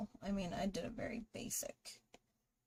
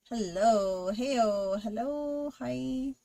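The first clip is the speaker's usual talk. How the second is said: loud playful greetings; 3 semitones higher, 10 dB louder, much slower than usual. Real, not acted. Streamer greeting a viewer in several ways, loud and playful. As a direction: belt out a string of hellos, loud.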